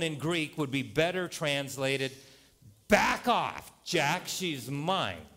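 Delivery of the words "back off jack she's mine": loud, high "back off", slowing into a firm claim; protective warning. Acted. Preacher voices Jesus defending a woman, protective warning. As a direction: warn off a rival, punching the first words.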